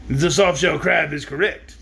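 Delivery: slow, lightly voiced wizard attempt; a failed try at the wizard. Shown as a demonstration. Reader retrying the wizard's line, slow. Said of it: "I can't do the wizard's voice".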